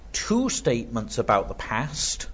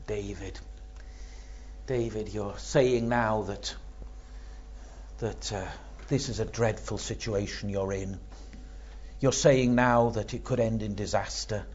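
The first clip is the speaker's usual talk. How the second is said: softer, intimate words to David; hushed address; 7 dB quieter than usual. Acted. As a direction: lean in and speak gently to David. Preacher softly addresses David.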